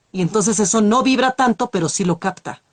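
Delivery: normal talking voice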